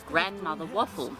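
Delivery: plain reading voice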